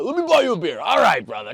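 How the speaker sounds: high, loud, over-friendly guy; gushing bro, hammed up; mock buddy enthusiasm. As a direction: burst out like an instant best pal. Acting out an eager guy's offer.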